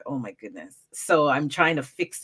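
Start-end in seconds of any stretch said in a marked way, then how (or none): 0.0-0.8 dismayed aside. Retold or imitated. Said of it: "I was like".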